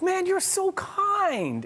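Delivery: high, drawn-out gushing reply. Retold or imitated. Host retelling his reply to the actor, gushing. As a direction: gush it way up high, stretching the words.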